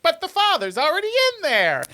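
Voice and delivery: high, baffled protest; mock disbelief. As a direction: imitate a confused bystander objecting. Retold argument over fathers.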